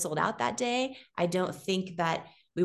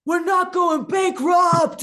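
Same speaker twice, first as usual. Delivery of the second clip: high, loud, slow joyful shout; excited shout; 10 semitones higher, 9 dB louder, slower than usual. Retold or imitated. Husband bursting through studio doors to wife, ecstatic shout. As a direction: yell it in wild relief. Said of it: "He was, like, so excited".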